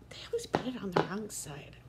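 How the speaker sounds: whispered under her breath; a quick half-voiced aside. Real, not acted. Muttered aside about her coffee.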